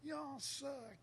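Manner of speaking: high jeering heckler, imitated; mock heckler jeer. Teller imitating hecklers jeering the band, high mock jeer. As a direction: sneer it like a drunk heckler.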